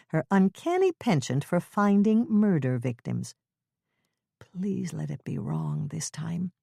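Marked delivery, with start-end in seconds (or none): 4.4-6.5 slow pleading whisper. Acted. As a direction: whisper it like a silent prayer. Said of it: "Hannah's mind begged".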